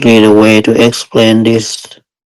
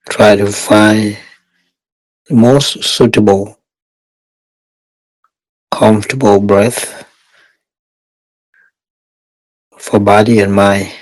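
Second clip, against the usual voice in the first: guided meditation. Real, not acted. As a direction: guide meditators step by step, unhurried and even.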